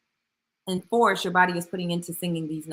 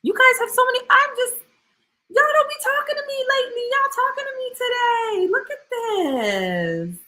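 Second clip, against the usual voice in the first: much higher, mock-sassy squeak teasing the chat; squeaky teasing voice; 4 dB louder than usual. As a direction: tease them in a tiny high voice.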